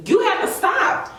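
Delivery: sudden high pleading; exasperated plea. Retold or imitated. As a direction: pitch it up in exasperation. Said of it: "because I'm like".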